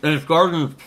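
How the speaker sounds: talking through a mouthful of burger; mouth full. Real, not acted. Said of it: "I'm talking with my mouth full".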